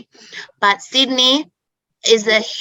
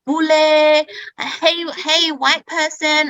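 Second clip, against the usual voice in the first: higher, teasing schoolyard taunt; mocking taunt; 6 semitones higher, slower than usual. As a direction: imitate kids jeering at the foreigner. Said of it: "always call me".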